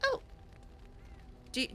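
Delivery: ordinary talk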